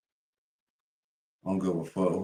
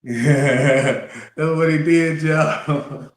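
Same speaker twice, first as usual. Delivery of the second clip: louder, higher amused retelling; excited; 6 semitones higher, 9 dB louder, much slower than usual. Real, not acted. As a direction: tell it loud through amusement. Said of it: "excited laughing recap".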